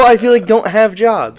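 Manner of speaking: high, loud scornful dig; heated jab. Real, not acted. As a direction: finish the rant with indignant force. Ranting at online match critics.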